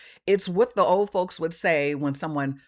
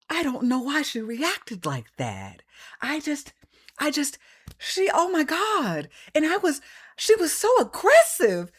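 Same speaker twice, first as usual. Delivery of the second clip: mock innocent victim; 11 semitones higher than usual. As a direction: play the fake victim clutching her pearls. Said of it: "is the first to say".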